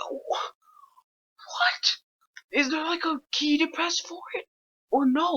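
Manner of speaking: high frantic panicked pleading; panicking as the door fails; scared horror game streamer. Real, not acted. Door won't shut, enemy near.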